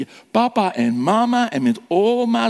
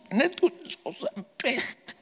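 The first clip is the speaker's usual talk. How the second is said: put-on higher and softer, full of pity for the boy; pitying narration; 6 semitones higher, 5 dB quieter than usual. A narrator's reading. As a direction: say it like you feel sorry for him, higher and softer. Repeat, in Lucas's despairing tone. (medelijdend, hoger en zachter)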